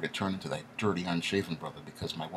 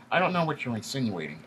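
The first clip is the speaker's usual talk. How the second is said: high, defensive nasal executive; higher boss voice, put on the defensive; pitched-up twang; 7 semitones higher, 4 dB louder than usual. A narrator's reading. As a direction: back away from the accusation in a raised, affected voice. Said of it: "Eric says, backing away".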